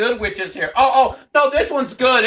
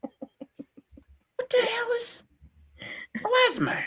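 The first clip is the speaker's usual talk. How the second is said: breathy unvoiced whisper; 12 semitones higher, 9 dB quieter, much slower than usual. Real, not acted. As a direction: whisper it aside. Whispered aside.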